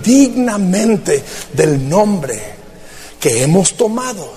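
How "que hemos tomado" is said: normal talking voice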